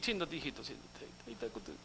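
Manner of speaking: consuelo burlón a un bebé, mucho más bajo y suave; mimando a un niño. Acted. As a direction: arrulla al creyente como a un niñito. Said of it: "no te da un globo y un chupete". (imitación burlona de quien mima a un niño, en voz baja)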